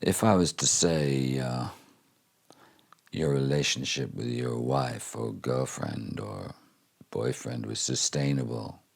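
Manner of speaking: slow, soft Southern American drawl, lightly imitated; a gentle Southern American drawl, unhurried. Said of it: "in his Southern American drawl".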